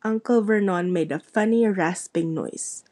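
usual speaking voice

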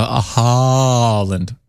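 Haaland with a long, stretched first vowel; drawn-out name. Pretend. Co-host playfully correcting the reader on Haaland, drawn-out name.

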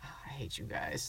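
whispering. Real, not acted. Whispered insult.

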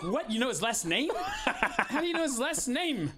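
can't believe he knows the surname; amazed laughing disbelief. Retold or imitated. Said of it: "I was like".

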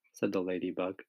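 usual speaking voice

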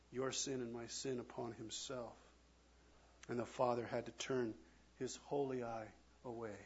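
ordinary delivery

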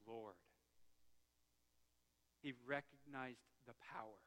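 very low, soft, weighty delivery; quiet, grave sermon voice with long pauses; solemn, hushed preaching. Real, not acted. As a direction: let it sink to a reverent hush. Preacher drops to solemn hush.

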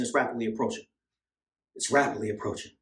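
soft, low hushed warning. Real, not acted. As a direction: let it fall to a near murmur. Hushed after preaching.

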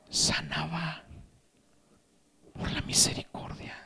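whispered, reverent answer; breath-only, tender preaching; hushed. Real, not acted. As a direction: sink into a soft whisper.

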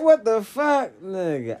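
high, loud disbelieving blurt; shocked outburst. Retold or imitated. Telling his friend how he reacted, a high shocked outburst. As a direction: throw it out in pure disbelief.

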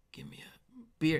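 soft slow whispered line; whispered order. Pretend. Comedian acting a bar customer, soft whisper. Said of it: "and it's like".

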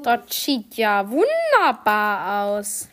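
slow, loud, put-on voice; posh mock admiration. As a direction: drawl it out like a snob. Repeats the praise in a put-on voice.

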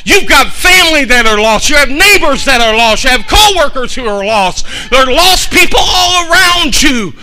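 loud, high preaching shout; full-force preaching. Acted. Pastor urging the church to reach the lost, shouted preaching. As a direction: preach it out to the rafters.

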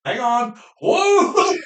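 high, loud whoop after a pause; imitated whoop. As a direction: cut off and holler with joy. Retelling hunter's whoop.

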